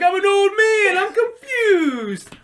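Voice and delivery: put-on high, loud, slow whiny old man; old man whine. Uncle Leo caught stealing, whiny old man. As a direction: wail like a confused old man. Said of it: "He's like".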